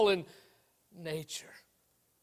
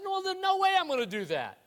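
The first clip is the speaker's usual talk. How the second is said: higher-pitched, balking turtle voice; balking refusal; 7 semitones higher than usual. Acted. Turtle turning down the scorpion's ride, balking. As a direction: refuse outright, pitched up high.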